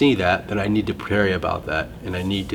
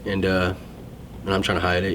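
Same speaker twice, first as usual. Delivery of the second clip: ordinary talk, nothing marked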